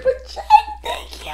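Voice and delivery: very high squeal, half laughing, half crying; tiny, high-pitched overjoyed squeak; tearful gratitude. Real, not acted. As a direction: squeal your thanks, overcome. Overwhelmed thanks to the gift giver.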